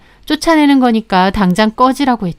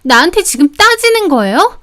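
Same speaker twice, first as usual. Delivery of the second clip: higher-pitched, affronted protest; indignant sister-in-law; 5 semitones higher than usual. Acted. Sister-in-law challenging her brother's wife, indignant. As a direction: push back at being told off, voice raised in pitch. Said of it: "얼굴이 발갛게 달아올라서".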